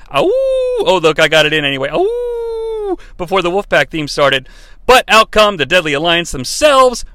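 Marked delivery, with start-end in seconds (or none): 0.1-1.0 high, sustained "ow-oo"; wolf howl. Pretend. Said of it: "See, I can't howl". 1.9-3.0 long high held howl; wolf howl. Pretend. Host imitates wolfpack theme intro, high howl. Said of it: "See, I can't howl".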